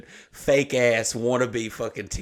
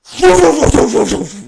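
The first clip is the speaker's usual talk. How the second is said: loud, high explosive laugh; burst of laughter; 13 dB louder than usual. Real, not acted. Telling a friend the son hit the ceiling, howling laugh. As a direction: crack up hard at the memory. Wild crash story punchline.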